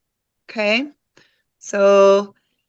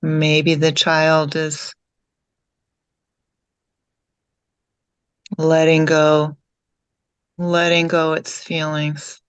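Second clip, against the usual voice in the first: calm meditation guide, lower than chatting, with long pauses; guided meditation; 4 semitones lower than usual. Real, not acted. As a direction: guide them gently inward, leaving long silences between phrases.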